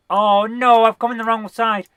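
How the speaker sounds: rushing, running out of power; being chased. Real, not acted.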